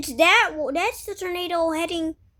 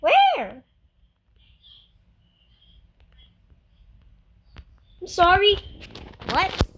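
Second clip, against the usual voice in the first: excited shout; 4 semitones higher, 5 dB louder than usual. Real, not acted. Startled by tornado in game.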